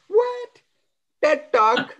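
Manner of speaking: high, indignant "What?"; mock surprise. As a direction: act baffled and offended. Reacting to dad's message.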